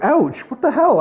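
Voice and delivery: high, mock-outraged yelp; shocked. Retelling being hit by a teammate's grenade, high yelp. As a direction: yelp it like you just got hit. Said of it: "'cause I was like".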